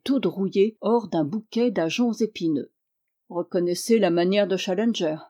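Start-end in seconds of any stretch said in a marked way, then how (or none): none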